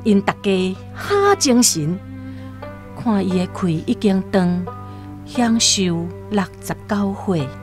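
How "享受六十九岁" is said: usual speaking voice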